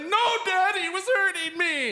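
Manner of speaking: very high, whining tattletale; a son telling on the bully to dad; whiny kid. Retold or imitated. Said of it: "he's like".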